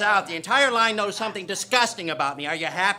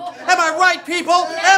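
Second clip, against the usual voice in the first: loud rallying; 8 semitones higher, 8 dB louder than usual. Acted. Passenger rallies the line.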